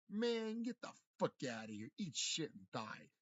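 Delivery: slow, loud, drawn-out yell; furious shout. Acted. Telling off imagined bosses, a slow furious shout. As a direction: bellow it slowly as a defiant dismissal. Ranting at imagined corrupt superiors.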